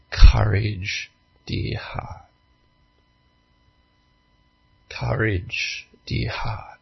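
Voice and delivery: soft, hushed, tender reassurance; lion's voice. Retold or imitated. Lion comforting Lucy in the darkness, soft hushed voice. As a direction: breathe it gently as comfort. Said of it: "she heard a lion's voice".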